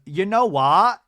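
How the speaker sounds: "what" with its final T left off, voice lifted; dropped T. Retold or imitated. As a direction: imitate Lisa swallowing the T in "what". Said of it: "doesn't have time to pronounce the T's".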